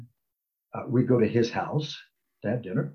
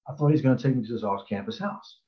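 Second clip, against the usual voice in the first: usual speaking voice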